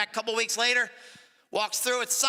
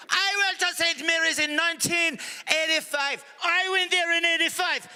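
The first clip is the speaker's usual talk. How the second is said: bursting with delight at the coincidence; thrilled; 9 semitones higher than usual. Acted. Irish guy thrilled to meet a classmate, high and excited.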